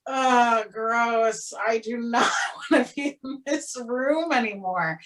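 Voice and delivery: drawn-out, half-laughing "ew" attitude; mock disgust. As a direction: recoil in exaggerated distaste. Retold inner reaction of disgust.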